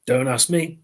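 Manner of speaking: ordinary delivery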